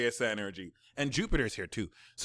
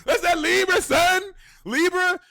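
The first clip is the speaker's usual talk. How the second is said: shrieked much higher and louder in pure glee; ecstatic shrieking; 10 dB louder, much slower than usual. Real, not acted. Reader shrieking with glee over the Libra placement. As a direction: scream it out like your team just scored.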